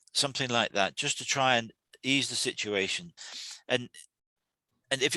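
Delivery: usual speaking voice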